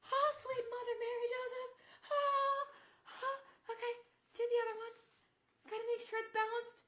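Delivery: very high, strained squeal; shocked by cold. Real, not acted. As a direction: squeak it out through freezing shock. Pulling on ice cold socks.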